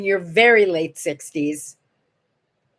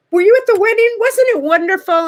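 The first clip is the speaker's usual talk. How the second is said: high, loud, thrilled questions; gushing delight; 11 semitones higher, 5 dB louder, faster than usual. Retold or imitated. Comedian asking the girlfriend about the wedding, gushing with delight. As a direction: gush over a shared memory, voice raised.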